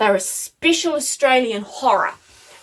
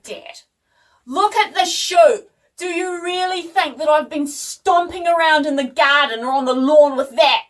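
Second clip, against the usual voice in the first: higher, louder, emphatic retort; indignant protest; 5 semitones higher, 5 dB louder than usual. Retold or imitated. Daughter defending her slippers to Dad, indignant protest. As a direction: act out an exasperated daughter.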